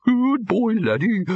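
high-pitched talking-dog yelp; high, thin doggy call; faint barking. A narrator's reading. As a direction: bark the words from far back, pitched right up. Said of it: "There was a faint barking".

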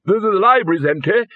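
high-pitched stammering bellow. A narrator's reading. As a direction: trip on the first word, then bellow. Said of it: "bellowed the Arch-Chancellor".

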